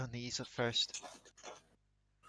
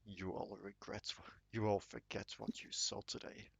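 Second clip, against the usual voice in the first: ominous demon voice; 8 dB quieter than usual. Acted. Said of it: "just the voice of".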